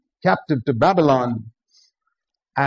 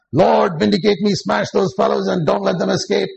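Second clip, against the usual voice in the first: lampoon a vengeful Sunday prayer, higher pitched; mocking churchgoer prayer; 6 semitones higher than usual. Preacher mocks churches praying for enemies to be smashed, high mocking prayer. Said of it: "every Sunday morning they get up and read a psalm".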